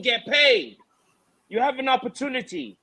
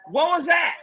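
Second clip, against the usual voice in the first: ordinary delivery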